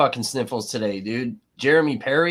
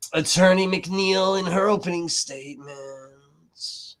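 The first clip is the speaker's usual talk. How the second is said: mock lawyer; 6 semitones higher, slower than usual. Mocking the lawyer's dramatic delivery.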